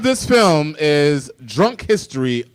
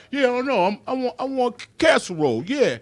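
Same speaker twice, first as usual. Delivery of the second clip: put-on British accent; 11 semitones higher than usual. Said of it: "He was like".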